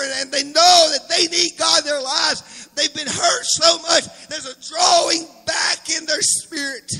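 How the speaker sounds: loud preaching, rising in fervour; fiery preaching. Acted. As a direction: preach it out to the whole church. Preaching to the congregation.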